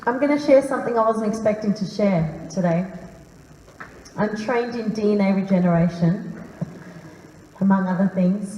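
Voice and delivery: ordinary talk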